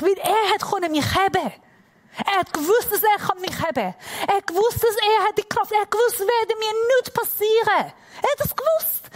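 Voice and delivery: high, emphatic, mounting insistence; impassioned repeated insistence; insistent preaching. Real, not acted. Preacher insists father knew his strength.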